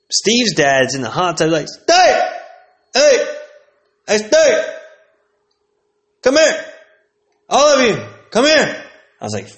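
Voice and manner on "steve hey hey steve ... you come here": high, loud yelps with gaps between; short, high-pitched mock shouts; imitated dad calling out. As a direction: holler for the kids way up high. Steve's dad calling from hot tub.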